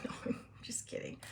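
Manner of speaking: soft, unvoiced take-back after a laugh; whispered aside. Real, not acted. To viewers after calling herself Superman, whispered. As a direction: take back the joke under your breath.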